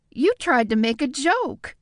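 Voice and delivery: delighted surprise at his joke; amused woman. Acted. Nadia laughing at his joke.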